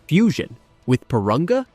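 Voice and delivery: rising disbelief. A narrator's reading. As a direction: echo it as a disbelieving question, pitch up. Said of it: "his voice rising in disbelief".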